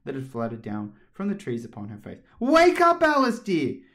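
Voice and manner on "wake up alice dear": high, loud, sing-out wake-up call. Acted. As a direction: call out to rouse a sleeper. Sister waking sleeping Alice.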